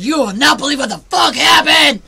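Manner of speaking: mock shout. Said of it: "He was like".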